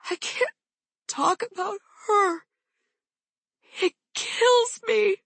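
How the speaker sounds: mother breaks down on the phone, sobbing; ragged, choked. A narrator's reading. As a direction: sob it out, barely holding on. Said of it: "in a ragged voice".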